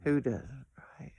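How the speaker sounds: unvoiced whispered aside. Real, not acted. Whispering an aside to her co-host, whisper. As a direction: breathe it out, no voice.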